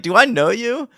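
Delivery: high, mock-puzzled question; mock puzzled Joyce, high-pitched. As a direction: play the confused mother. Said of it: "she's like".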